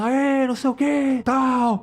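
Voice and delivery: imitated crowd yelling. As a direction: imitate a rowdy gang shouting from the street. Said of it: "ouvindo a galera gritando".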